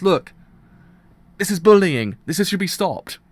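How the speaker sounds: angry shouting. Real, not acted. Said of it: "I shouted a bit too much".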